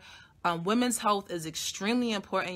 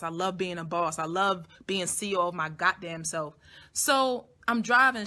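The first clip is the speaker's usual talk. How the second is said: usual speaking voice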